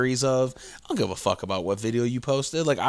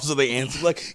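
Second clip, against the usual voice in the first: usual speaking voice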